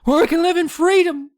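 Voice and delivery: high squeaky little gnome, pleading; very high pinched gnome whine; tiny gnome begging to be set free. Acted. Reading gnome dialogue in squeaky voice.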